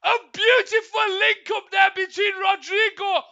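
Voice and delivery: shouting live play-by-play; excited goal call. Real, not acted. Goal commentary at full force.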